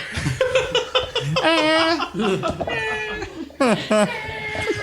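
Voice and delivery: high helpless laughter fit; laughing fit. Real, not acted. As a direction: crack up completely. GM cracks up at player death.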